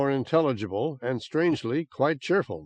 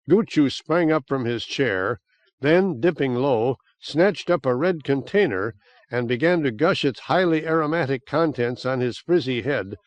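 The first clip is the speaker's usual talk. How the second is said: usual speaking voice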